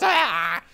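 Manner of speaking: imitated loud, high cry; freaked-out wail. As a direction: cry out as if losing it. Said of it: "He's freaking out".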